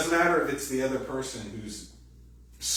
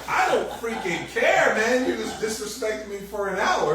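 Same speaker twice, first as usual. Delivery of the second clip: exasperated husband; 10 semitones higher, 6 dB louder than usual. Retold or imitated. Husband fed up after an hour of attitude, mock rant. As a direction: blow up half laughing, fed up. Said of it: "he's like".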